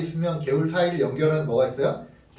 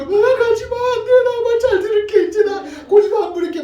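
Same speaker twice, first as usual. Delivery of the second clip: very high, loud imitation of a bawling child; hammed-up childish crying plea; mock wailing; 6 dB louder than usual. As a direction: wail and beg in exaggerated sobs. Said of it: "막 엉엉 서럽게".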